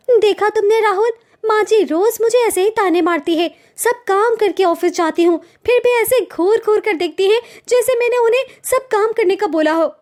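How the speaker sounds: a wounded daughter-in-law venting to her husband; young wife complaining. Acted.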